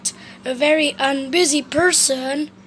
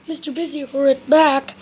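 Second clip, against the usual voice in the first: plain reading voice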